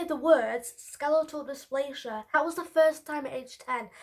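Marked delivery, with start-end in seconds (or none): none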